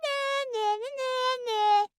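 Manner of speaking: high singsong taunt. Acted. Acorn teasing the squirrels, singsong taunt. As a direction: tease them, nana style. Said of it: "taunting the squirrels".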